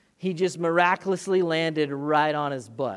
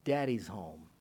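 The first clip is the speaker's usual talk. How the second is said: softer, lower happy greeting; tender daddy's home; 7 semitones lower, 6 dB quieter than usual. Retold or imitated. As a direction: welcome him home gently. Kids greeting dad at the door.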